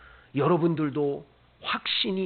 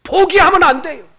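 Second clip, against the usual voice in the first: loud, much higher urging; loud exhortation; 10 semitones higher, 13 dB louder than usual. Acted. Preacher urging the congregation never to give up, loud. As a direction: drive the plea home.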